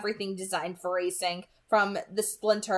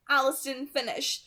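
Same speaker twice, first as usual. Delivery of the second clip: usual speaking voice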